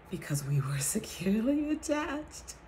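ordinary talk, nothing marked

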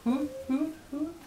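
high, rising owl hoots; high hooting for the baby. Pretend. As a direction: hoot up high like a playful owl. Parent imitating owl for toddler.

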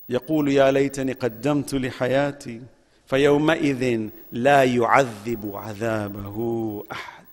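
low, soft, measured Quranic chant; Quran recitation. Acted. Recites the verse again.

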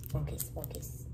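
self-directed whisper, low and soft. Real, not acted. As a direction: whisper to yourself to focus. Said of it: "whispers to herself".

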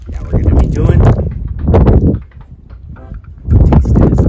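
loud, punchy announcement; loud announcer. Acted. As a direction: shout it like a hype host. Announcing taste test.

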